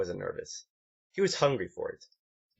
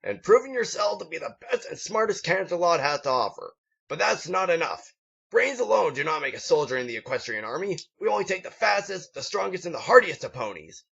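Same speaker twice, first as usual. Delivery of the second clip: higher, proud rallying sergeant voice; patriotic drill instructor; 7 semitones higher than usual. A narrator's reading. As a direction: swell with patriotic pride. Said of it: "further patriotic outbursts".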